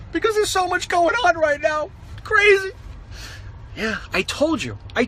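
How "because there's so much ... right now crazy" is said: high, wound-up rambling; buzzing with street excitement; excited. Real, not acted.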